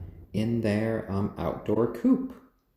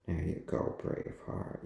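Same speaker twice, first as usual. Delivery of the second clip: softer, half-whispered comfort; soft soothing; 3 semitones lower, 9 dB quieter than usual. Real, not acted. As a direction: hush the bird gently. Soothing the chicken.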